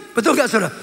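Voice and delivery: ordinary delivery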